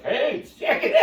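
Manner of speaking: high, loud, laughing put-on voice; laughing mock protest. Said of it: "I'm like".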